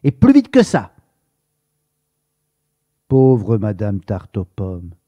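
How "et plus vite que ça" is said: high, loud, snappish order; grumpy customer snapping at the shopkeeper; curt and impatient. A narrator's reading. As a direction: hurry him up rudely. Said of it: "d'un ton sec".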